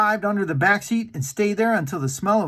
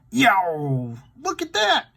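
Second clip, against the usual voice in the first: startled yelp; 4 semitones higher, slower than usual. Acted. Shaggy sees the ghost.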